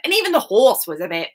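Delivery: ordinary delivery, nothing marked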